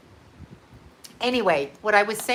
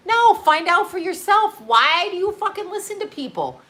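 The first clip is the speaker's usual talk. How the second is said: high, raised voice, fed up; pitched-up exasperated outburst; exasperated rant; 7 semitones higher than usual. Real, not acted. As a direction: push it up, incredulous. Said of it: "ranting at viewers".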